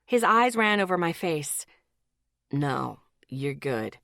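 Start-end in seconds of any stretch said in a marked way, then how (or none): 2.5-4.0 slow, low and reassuring; calm him down gently. A narrator's reading. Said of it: "he reassured me".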